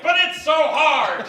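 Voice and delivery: high, loud, slow mock whine; whiny protest. Comic whining back at the angry driver. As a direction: wail it helplessly. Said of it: "And I'm like".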